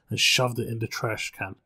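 ordinary delivery

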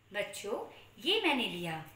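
normal talking voice

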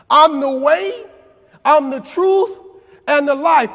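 preached full force. Shown as a demonstration. Preacher proclaiming Jesus' words to the congregation, shouting. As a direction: shout the verse to the back pews. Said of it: "So what Jesus says".

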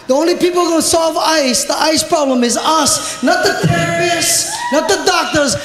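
usual speaking voice

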